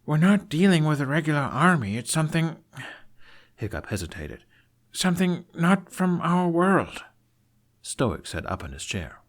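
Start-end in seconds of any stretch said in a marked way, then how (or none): 0.1-2.6 put-on higher, urgent young man voice; urgent Hiccup. Hiccup urging his father. 4.9-7.0 put-on slow, higher, hesitant young man; hesitant Hiccup.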